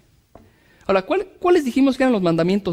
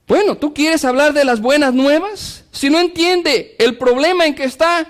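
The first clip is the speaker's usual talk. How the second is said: urgent, rising sermon delivery; fervent preaching; 6 semitones higher than usual. Acted. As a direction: press the point home from the pulpit. Preaching at full force.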